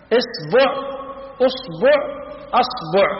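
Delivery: إصبع، أصبع، أصبع as isbu', usbu', asbu'; damma on the ba. Shown as a demonstration. Teacher lists finger variants, إصبع، أصبع، أصبع each ending -bu'. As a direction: list إصبع، أصبع، أصبع changing the first vowel, u after b. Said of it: "بضم الباء مثلثة الهمز".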